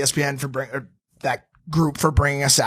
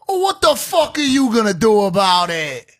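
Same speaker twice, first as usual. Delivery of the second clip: high shouted taunt; raised, pitched-up heckler yell; heckler's challenge; 5 semitones higher, 4 dB louder than usual. Retold or imitated. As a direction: holler it as a belligerent challenge. Heckler challenging Logan, acted out.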